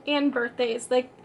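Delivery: ordinary talk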